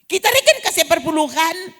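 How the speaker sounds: mock whining complaint to the pastor; whiny complaint. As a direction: moan like a church member who feels cheated. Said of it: "dia bukan bilang begini".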